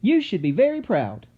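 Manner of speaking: high, loud cheerful praise; teacher cheering a student's poster, high and loud; excited teacher. A narrator's reading. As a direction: gush over the work like a proud teacher.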